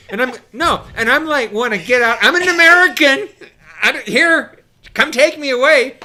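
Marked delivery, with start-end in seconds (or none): 1.5-6.0 much higher and louder, frantic half-laughing plea; panicked pleading. Retold or imitated. Scared American begging the gunship to take him, panicked pleading. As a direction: beg to be taken off the boat, mock panic.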